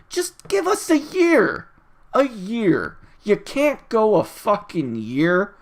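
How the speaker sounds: high, drawn-out exasperated plea. Real, not acted. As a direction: stretch the words out in disbelief. Exasperated retort to impatient complainers.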